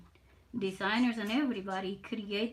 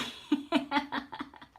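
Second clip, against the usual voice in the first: airy laugh, slightly higher; breathy laugh; 5 semitones higher than usual. Real, not acted. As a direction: let out a light breathy laugh. Laughs after "this is me.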